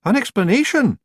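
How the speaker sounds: high, loud, nervous Scots pixie voice; uneasy. Acted. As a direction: stall for time nervously. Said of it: "he said, shifting uneasily".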